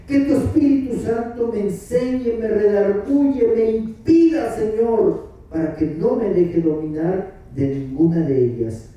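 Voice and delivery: high, impassioned prayer demo; fervent prayer. Acted. Preacher showing the church how to pray. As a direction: pray aloud with rising fervor.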